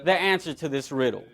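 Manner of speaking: mock indignation, fast and rising before it drops; acted scoff. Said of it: "Samson scoffs and says".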